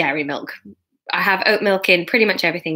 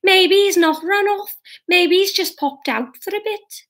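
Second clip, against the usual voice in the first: mother's voice; 11 semitones higher than usual. A narrator's reading. Catherine voiced high in story.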